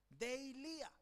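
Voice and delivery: "deilia" stressed on the final I, dei-LI-a; stress on last I. Shown as a demonstration. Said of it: "con tilde en la I". ("deilia" con acento en la última i)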